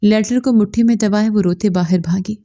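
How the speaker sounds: ordinary delivery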